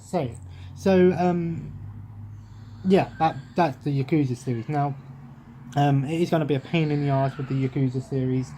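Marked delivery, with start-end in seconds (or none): none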